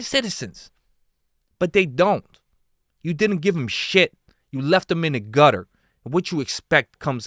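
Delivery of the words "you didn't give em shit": normal talking voice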